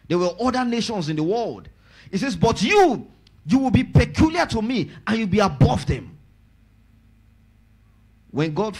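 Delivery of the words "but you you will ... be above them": high, loud promise; preaching. Acted. Pastor declaring God's favor over the church, loud preaching.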